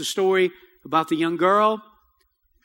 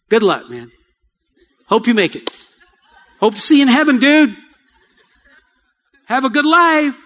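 loud, breezy brush-off, higher and louder; mock dismissive send-off; 4 semitones higher, 6 dB louder than usual. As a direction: toss off a careless goodbye, hammed up. Mocking a shallow evangelist's brush-off.

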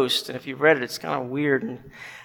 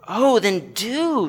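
higher, drawn-out plea; urging bright man; 5 semitones higher, much slower than usual. Acted. Bright man replies at once to the ghost, urging. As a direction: stretch it into an eager urging.

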